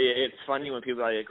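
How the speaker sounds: ordinary talk, nothing marked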